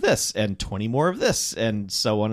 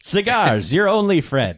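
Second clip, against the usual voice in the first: higher, louder vintage ad announcer; old-timey voice; 7 semitones higher, 5 dB louder, slower than usual. Pretend. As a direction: sell it like a fifties ad. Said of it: "the old-timey voice".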